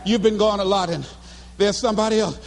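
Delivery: lightly voiced girlfriend, low and halting; hesitant breakup. Girlfriend admits there's somebody else, hesitant.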